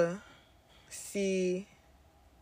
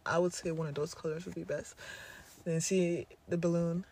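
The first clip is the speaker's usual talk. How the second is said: guided meditation; 3 dB quieter than usual. Acted. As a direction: lead them calmly through the breath. Guides breathing visualisation.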